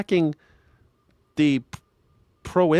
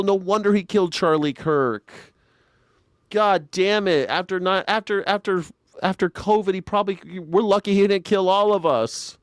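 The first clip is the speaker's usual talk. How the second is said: ordinary talk, nothing marked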